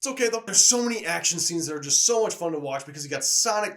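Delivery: ordinary talk, nothing marked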